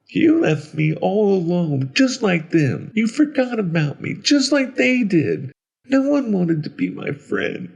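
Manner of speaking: put-on high-pitched child voice, hurt and accusing; small, high voice full of hurt; wounded little boy. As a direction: plead like an abandoned little boy. Left-behind boy accuses the listener.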